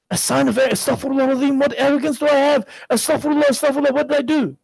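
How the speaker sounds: a pious man suddenly alarmed at his own fault; panicked self-reproach. Retold or imitated. Shaykh panics at the accusation.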